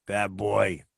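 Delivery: low, soft, sheepish tough-guy voice; low apologetic mobster voice; John's voice on the phone. Retold or imitated. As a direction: play the remorseful hothead, low and hushed. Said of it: "Here's the voice I get".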